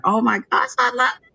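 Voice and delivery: high, delighted squeal of approval; excited. Real, not acted. Reader thrilled by her great reading, excited. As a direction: gush with real excitement, pitch leaping up. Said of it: "I am so excited".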